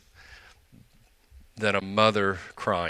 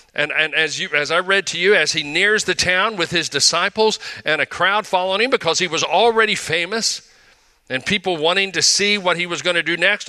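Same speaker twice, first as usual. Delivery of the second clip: raised, louder preacher's storytelling voice; fervent preaching; 7 semitones higher, 4 dB louder than usual. Acted. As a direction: preach it out to the congregation with energy. Sermon storytelling, rising energy.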